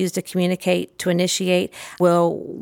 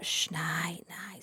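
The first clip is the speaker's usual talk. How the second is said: hushed whisper, much softer; whispered shh; 17 dB quieter, much slower than usual. Shown as a demonstration. Putting the toy person to sleep, whispered. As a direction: breathe it out like a child is sleeping. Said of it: "hunch over".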